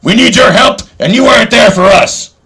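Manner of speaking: very high, loud mock challenge shouted all through; hammed-up callout. A man calls out his rival Captain Bayonne, shouting high and loud. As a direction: shout it like a campaign rally. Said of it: "I'm gonna make a clip".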